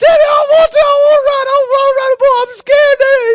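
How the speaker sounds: put-on high, loud childish wailing; mock crying boy. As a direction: wail to Daddy in hammed-up tears. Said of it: "He's crying".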